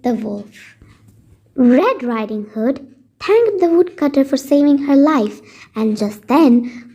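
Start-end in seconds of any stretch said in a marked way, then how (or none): none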